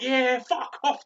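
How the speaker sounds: high, drawn-out mock heckle; jeering kids. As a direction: shout it like children joining the jeering. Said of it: "the kids are like".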